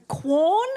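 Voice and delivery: Quorn? with a high rising question lilt; apologetic question. Shown as a demonstration. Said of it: "It's so apologetic".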